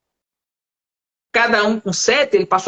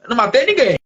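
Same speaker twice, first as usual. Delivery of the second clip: retold protest, pitched up; indignant denial; 4 semitones higher than usual. Pastor answering the woman's accusation, indignant retold denial. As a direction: deny it emphatically, a bit higher.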